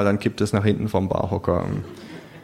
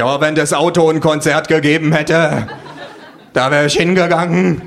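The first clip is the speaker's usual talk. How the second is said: louder, raised scornful retort; 7 semitones higher, 5 dB louder than usual. Acted. Narrator mocking Hasselhoff to the kangaroo, scornful. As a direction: make the car sound worth it. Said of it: "sag ich verächtlich".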